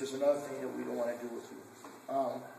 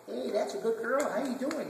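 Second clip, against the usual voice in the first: higher, louder gushing hello to the dog; eager stranger greeting; 9 semitones higher, 4 dB louder than usual. Retold or imitated. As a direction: gush over her like an overeager stranger. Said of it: "They're just gonna come up and".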